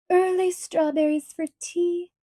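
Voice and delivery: high delighted exclamation; delighted cry of Miss Lavendar; exclaiming. A narrator's reading. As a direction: burst out with glee. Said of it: "exclaimed Miss Lavendar".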